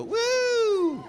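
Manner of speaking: high, loud cheer of triumph; excited whoop. Retold or imitated. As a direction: let out a big woo. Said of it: "That's when you go".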